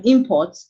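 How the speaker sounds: IMport, loud front stress; "import" as a noun, stressed on the first syllable. Shown as a demonstration. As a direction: punch "im" in "import". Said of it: "the stress is on the first syllable".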